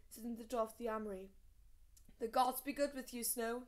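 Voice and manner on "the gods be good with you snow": called across the yard, raised voice; calling out. A narrator's reading. Armorer calling a greeting across the yard. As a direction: hail him from the armory door. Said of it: "he called out".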